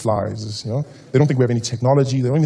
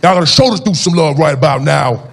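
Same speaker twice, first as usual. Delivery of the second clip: hype MC; 5 dB louder than usual. Retold or imitated. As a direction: pump up the crowd like an emcee. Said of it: "he's like".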